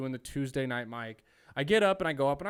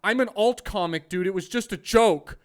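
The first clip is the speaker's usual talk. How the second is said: mock defensive comic; 6 semitones higher, 10 dB louder than usual. Mocking the other comic's excuse.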